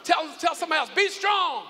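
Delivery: loud, high shouted preaching; preaching at full force. Acted. Pastor rousing the congregation with shouts. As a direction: drive the call home from the pulpit.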